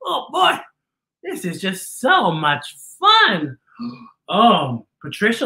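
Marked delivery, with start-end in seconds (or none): 0.0-0.6 put-on high, slow creaky old-man voice; hard-of-hearing old man. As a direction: strain to hear like a deaf old man. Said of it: "can't hear".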